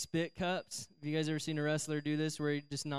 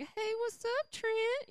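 very high mock girly voice; admiring girls; slower than usual. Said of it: "all the girls like".

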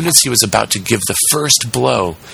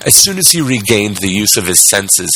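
usual speaking voice

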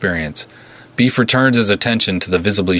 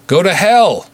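higher, louder, clipped defiant shout; terse retort; 11 semitones higher, 5 dB louder than usual. A narrator's reading. Ingrid defying the Russian goons, loud terse retort. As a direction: spit the defiance back at him. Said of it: "Ingrid's terse retort".

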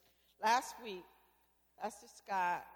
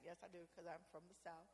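ordinary talk

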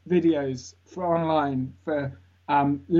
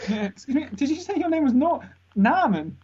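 confused stranger; 9 semitones higher than usual. Retold or imitated. Confused stranger mishearing his name.

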